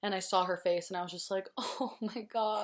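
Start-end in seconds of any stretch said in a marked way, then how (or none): 1.6-2.6 higher, drawn-out gasp of relief, re-enacted; overjoyed relief. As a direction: retell a flood of relief, slowly stretched out. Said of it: "every happy emotion was released".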